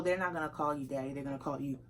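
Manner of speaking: normal talking voice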